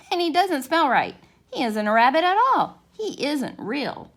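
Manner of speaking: high, loud taunting rabbit voice; mocking furry rabbit. A narrator's reading. Furry rabbit taunting the toy rabbit, high and loud. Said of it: "the furry rabbit called out".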